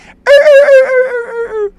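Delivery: high, loud mocking cackle; exaggerated "er-er-er" laugh imitation; mocking laugh noise. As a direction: imitate their laughing in a piercing squeal. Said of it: "That's what you guys sound like".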